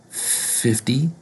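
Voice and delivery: slow "fifty" as "fifdy", long F, rising; softened T. Shown as a demonstration. Teacher introducing fifty, softened T. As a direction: lean on the F in "fifty", soften T toward D. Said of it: "we say fifty in American English".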